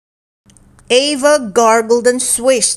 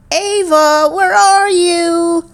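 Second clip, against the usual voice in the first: higher, calling, slow on the question; 8 semitones higher, 3 dB louder, slower than usual. A narrator's reading. Nina the dinosaur searches for her hidden friend Ava, calling. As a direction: call for a friend who's hidden. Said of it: "cried Nina".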